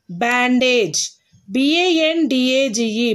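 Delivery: usual speaking voice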